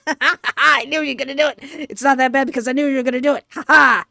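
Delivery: rapid, giggly gloating; laughing triumph. Real, not acted. Player who saw the jump scare coming, laughing triumph. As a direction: crow it through laughter, rushing the words.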